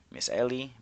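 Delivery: normal talking voice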